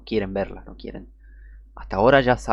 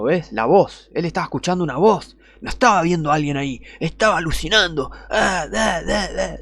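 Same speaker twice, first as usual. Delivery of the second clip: higher, mocking skeptic voice; mocking atheist; 6 semitones higher, 3 dB louder than usual. Preacher imitating an atheist scoffing at scripture, mocking. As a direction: play the scoffing atheist gloating. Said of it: "imagínate un ateo agarrando y diciendo".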